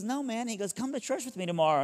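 high, insistent invitation; lightly hammed buddy. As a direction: press him, a bit goofy.

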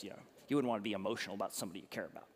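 a hint of a dismissive family muttering; unemotional in-laws. Bride's family explaining away the crying groom, lightly voiced. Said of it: "so they're like".

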